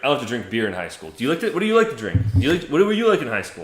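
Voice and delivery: loud, fast, high defensive rant; Brett Kavanaugh impression. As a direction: rattle it off like nominee. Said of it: "pull a Brett Kavanaugh".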